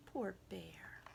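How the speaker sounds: soft pitying whisper. A narrator's reading. Narrator whispering pity for the crying bear. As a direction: sigh it kindly.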